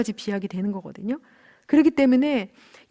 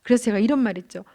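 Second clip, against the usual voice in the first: normal talking voice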